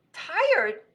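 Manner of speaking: high, loud one-word question; incredulous echo. Acted. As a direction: throw the word back in disbelief. Rabbit incredulous at tired robot.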